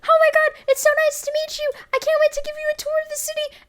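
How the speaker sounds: very high, chirpy cartoon voice; cheery little guy voice. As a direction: squeak it out like a happy tour guide. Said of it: "this little guy was like".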